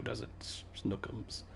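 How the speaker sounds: cooing whisper. Real, not acted. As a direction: whisper a pet name to a sleepy cat. Cooing over her tired cat.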